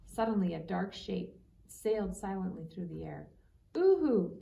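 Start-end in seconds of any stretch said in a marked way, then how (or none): 3.7-4.4 high, loud holler. A narrator's reading. Boy spots his owl, hollering high and loud. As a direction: call out across the woods. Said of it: "Lawrence hollered".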